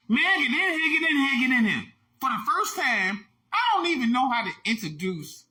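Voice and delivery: normal talking voice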